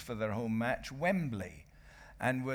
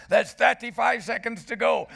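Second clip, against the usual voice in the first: shouted far higher and louder; commentary shout; 11 semitones higher, 10 dB louder than usual. Retold or imitated. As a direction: yell "thirty-five" like live commentary. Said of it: "and my commentary went".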